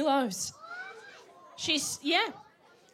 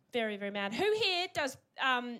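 usual speaking voice

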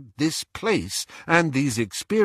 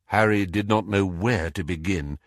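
ordinary delivery, nothing marked

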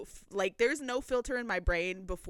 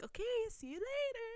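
high, soft farewell; retold sweet goodbye; 13 semitones higher, 7 dB quieter than usual. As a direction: imitate a gentle sing-out goodbye.

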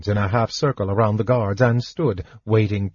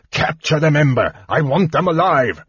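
enraged command; 5 semitones higher than usual. A narrator's reading. Verminard orders the dragon to seize spies, furious. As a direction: bark it at the dragon. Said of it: "yelled Verminard in a rage".

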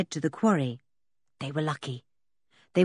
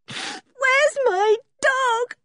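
very high, slow tearful wail; distraught, crying girl; sobbing; much slower than usual. A narrator's reading. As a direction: sob it out, stretching the words. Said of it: "she sobbed".

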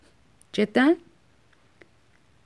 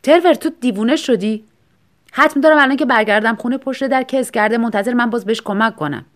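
louder, higher upset outburst; loud dismayed protest; upset shout; 4 semitones higher, 6 dB louder than usual. A narrator's reading. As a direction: cry out in distress at a friend. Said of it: "هیو با ناراحتی داد زد".

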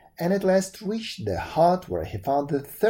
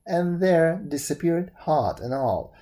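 ordinary delivery, nothing marked

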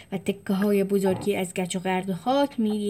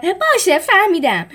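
higher, louder angry yell; shouting; 9 semitones higher, 8 dB louder than usual. Acted. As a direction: snap it out, fed up. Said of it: "جولیوس داد زد".

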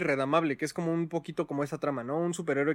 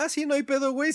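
higher, careless shrug of a voice; mock Tony Stark; 8 semitones higher than usual. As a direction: play him as not caring at all. (imitación burlona de Tony Stark despreocupado)

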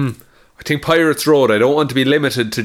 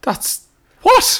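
high, startled that's, what; stunned at hearing he bit someone; shocked disbelief. Real, not acted. Friend hears a player bit a man, shocked.

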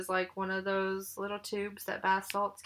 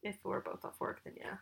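whispering; 12 dB quieter than usual. Real, not acted. Answer drops to whisper.

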